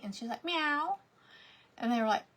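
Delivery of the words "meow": higher meow rising at the end; questioning meow. Retold or imitated. Girl meowing when the caller asks if it's Haley. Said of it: "only communicate meowing".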